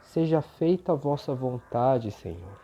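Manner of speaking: soft, devout prayer voice. Acted. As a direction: pray gently and reverently. Opening prayer over music.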